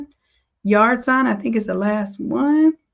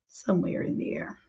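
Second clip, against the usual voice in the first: whisper; 3 semitones lower, 10 dB quieter than usual. Real, not acted. Muttered aside while searching.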